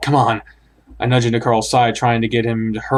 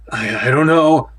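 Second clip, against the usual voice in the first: frightened stammer. Acted.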